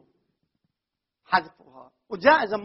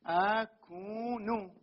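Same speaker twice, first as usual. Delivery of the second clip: slow drawn-out demo; 8 dB quieter, much slower than usual. Shown as a demonstration. Teacher showing students the full form, very slowly. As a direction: stretch أكون out very slowly for the class. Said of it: "هذه أكون".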